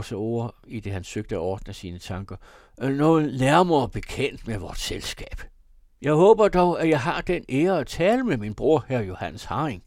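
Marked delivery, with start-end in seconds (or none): 2.8-9.8 high, loud tipsy formality, settling at the end; drunken proclamation. A narrator's reading. Drunk brother greeting his brother, loud and high. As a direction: announce it pompously at full voice.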